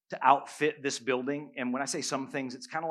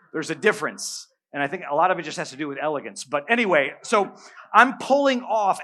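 ordinary talk

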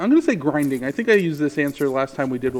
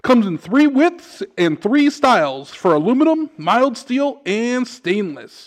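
radio ad read; 5 semitones higher, 5 dB louder than usual. Acted. Reads a mock hardware store ad.

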